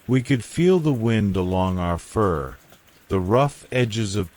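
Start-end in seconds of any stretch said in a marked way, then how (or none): none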